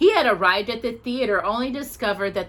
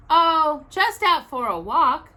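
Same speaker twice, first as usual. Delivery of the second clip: vaguely; 9 semitones higher, 5 dB louder, slower than usual. A narrator's reading. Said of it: "said Paddington vaguely".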